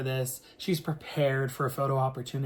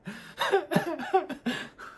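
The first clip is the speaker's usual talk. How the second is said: high embarrassed laugh. Real, not acted. Laughing at own frozen moment.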